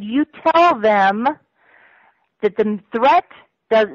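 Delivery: slow, each word weighed; slow emphatic dictation of a threat. Retold or imitated. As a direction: spell out the order word by word. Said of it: "He was emphatic".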